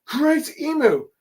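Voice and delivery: very high, stretched mock cry; acted exclamation. Killingham calls on Emu, high-pitched mock exclamation. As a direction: shoot the pitch way up and draw it out.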